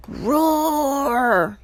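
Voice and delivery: long, high roar; narrated roar with rising rage; monster roar. A narrator's reading. Medusa answers Grover's guess with a roar. Medusa roars back to life.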